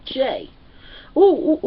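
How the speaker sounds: ordinary delivery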